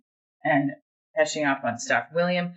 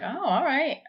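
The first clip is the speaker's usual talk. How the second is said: mock surprise; 10 semitones higher, slower than usual. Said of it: "I was like".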